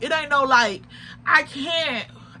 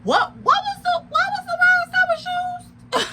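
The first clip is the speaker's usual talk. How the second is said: hammed-up wounded kid voice; mock whiny disbelief. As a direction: whine it up high in fake disbelief. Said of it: "So I'm like".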